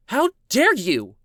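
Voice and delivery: high, drawn-out indignant cry; appalled and offended; outraged. Acted.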